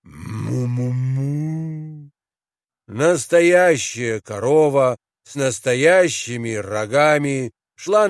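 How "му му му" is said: long, slow, soft moo; cow mooing. A narrator's reading. Cow walking along the road, mooing. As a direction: moo slowly like a real cow. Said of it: "и мычала".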